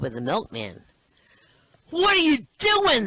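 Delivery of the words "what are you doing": high, loud outraged yell; husband shouting at his cheating wife. Acted. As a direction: catch them in the act, shouting. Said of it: "the man shouts".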